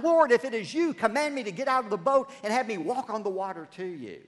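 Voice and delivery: Peter calls out high and loud from the boat; a loud, pleading cry across the water. Acted. Peter in the boat calling out to Jesus, loud pleading cry. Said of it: "Peter cries out".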